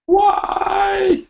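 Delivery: high squeaking yelp of surprise; real shock at the cold; startled cry. Real, not acted.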